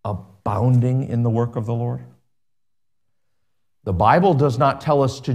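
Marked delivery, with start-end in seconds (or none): 0.0-2.0 abounding leaned on as the key word; preacher spotlighting abounding; stress on abounding. Shown as a demonstration. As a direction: hold up the word abounding for the church. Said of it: "emphasize to you that word".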